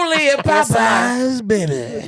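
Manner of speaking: slow, high mock commercial plug; hammed-up ad voice. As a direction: sell it like a cheesy ad. Playful ad-style plug.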